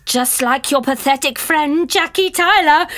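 gloating threat with an evil laugh; menacing villain voice. Acted. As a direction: play the sinister double. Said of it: "only messing".

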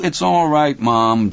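muffled against chest. Acted. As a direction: speak into a shirt. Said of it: "muffled against Hal's chest".

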